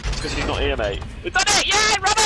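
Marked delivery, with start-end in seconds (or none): 1.2-2.2 high, loud cheer of triumph; excited shout. Real, not acted. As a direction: yell it out in victory. Cheers after escaping the killer.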